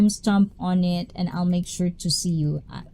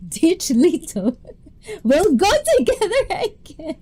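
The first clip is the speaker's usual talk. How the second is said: laughing; 9 semitones higher than usual. Real, not acted. Joking with friend, laughing.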